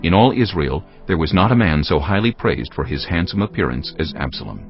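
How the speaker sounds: ordinary talk, nothing marked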